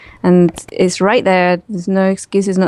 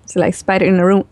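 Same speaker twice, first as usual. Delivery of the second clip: ordinary talk, nothing marked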